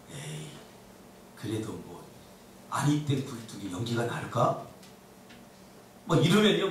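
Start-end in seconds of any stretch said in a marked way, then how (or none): 0.1-4.6 low, soft dismissive mutter; acted sceptical aside; disbelieving brush-off. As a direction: wave it away under your breath, doubting. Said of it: "저쪽에서".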